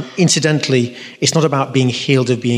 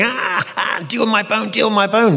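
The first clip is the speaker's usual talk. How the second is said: high-pitched wail of pain; acted panicked cry, pleading in agony; hammed-up injured patient begging for help; mock agony; 9 semitones higher than usual. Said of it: "I'm in agony".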